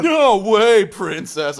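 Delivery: high, laid-back surfer dude. Pretend. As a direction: drawl it like a beach surfer. Surfer Link voice.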